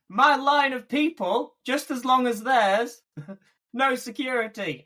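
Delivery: comic aggrieved protest, breaking into a laugh; mock indignant. Real, not acted. Mock complaint about lacking security.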